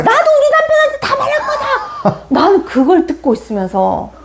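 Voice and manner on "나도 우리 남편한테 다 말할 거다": very high, mocking tattletale retort; childish mom. Mom outdoing her child's threat, high hammed-up retort. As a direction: parody a mom squabbling like a small child. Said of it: "또 뭐라고 하는지 아니?".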